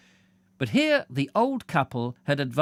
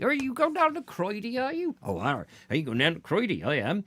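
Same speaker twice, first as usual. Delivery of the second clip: playful West Country yokels, pitch swooping up; West Country accent; 7 semitones higher than usual. Pretend. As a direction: put on a West Country burr. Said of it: "a sort of West Country".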